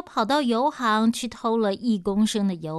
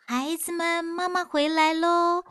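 thin sweet voice; 9 semitones higher than usual. Acted. Monster imitating mother after drinking oil, high and sweet. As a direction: lure them in with a high sugary mother's voice. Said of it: "山老的声音变细之后呢".

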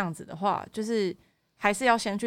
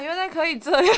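high, loud burst of surprise; amazed realization; 8 semitones higher, 7 dB louder than usual. Real, not acted. Listener learning a guilt-trip trick from the guest, amazed. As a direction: exclaim it as a delighted discovery.

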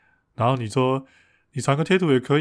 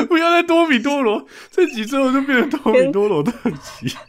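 laughing protest; 13 semitones higher, 5 dB louder than usual. Real, not acted. Laughing protest at a running joke.

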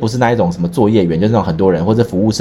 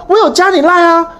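surprised retort; 4 dB louder than usual. Retold or imitated. Friend retorting on the phone.

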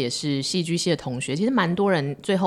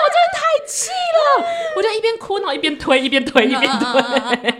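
laughing shout; 7 dB louder than usual. Real, not acted. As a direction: shout the anger through laughter. Recalling rage, laughing.

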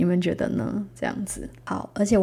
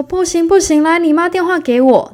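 high, loud firm refusal; insistent nurse; 10 semitones higher, 8 dB louder than usual. Retold or imitated. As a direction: take charge and demand the number. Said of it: "她说".

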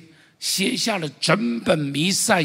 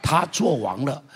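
ordinary delivery

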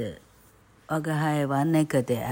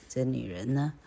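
ordinary delivery, nothing marked

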